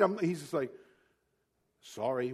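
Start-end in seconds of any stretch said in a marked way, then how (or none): 1.8-2.3 low, soft Canadian apology; meek sorry in an imitated Canadian accent. Canadian friend apologizes to angry driver, meek Canadian accent. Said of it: "with his Canadian accent".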